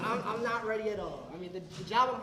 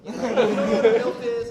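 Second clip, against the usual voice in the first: laughing; 3 semitones higher, 17 dB louder than usual. Real, not acted. Laughing at own joke.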